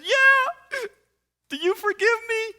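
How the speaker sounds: very high, loud squeal; imitated anxious woman. Fiancée begging him to forgive her, squeaky imitation. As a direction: plead in a squeaky mock-female voice.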